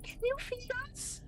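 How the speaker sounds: very high, very soft eerie plea; a tiny ghostly ask; soft monster voice. Acted. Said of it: "the soft one says".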